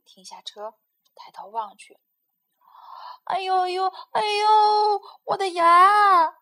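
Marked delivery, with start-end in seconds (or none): 3.2-6.4 moaning in pain. Acted. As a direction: wail about the tooth, milking it. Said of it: "花袜子叫个不停". (拖长声音夸张地喊牙疼)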